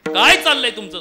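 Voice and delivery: high, full-voiced yell of protest; outraged shout. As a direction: act a servant bellowing at his master, appalled. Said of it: "मोठ्यानं ओरड-, ओरडला". (रागाने मोठ्यानं ओरडणे)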